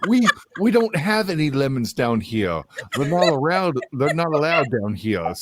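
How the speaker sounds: high-pitched mock Southern voice; hammed-up Southern accent; put-on Southern accent. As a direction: play a flustered Southern type, pitched high. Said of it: "we're still like this".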